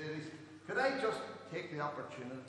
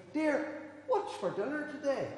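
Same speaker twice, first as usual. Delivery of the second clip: high-pitched call down the hall; 11 semitones higher, 3 dB louder, slower than usual. Acted. Doctor showing a husband what to call to his wife, calling out. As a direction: call to someone out of sight. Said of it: "down the hall and shout".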